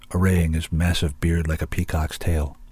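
ordinary delivery

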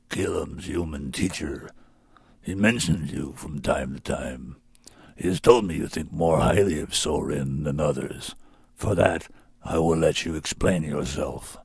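a huge beast judging its captive; low, measured beast speech; Sorin beast voice. A narrator's reading.

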